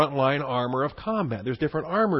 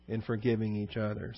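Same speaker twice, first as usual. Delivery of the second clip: soft echo; 3 semitones lower, 9 dB quieter than usual. Shown as a demonstration. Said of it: "reads then echoes the phrase".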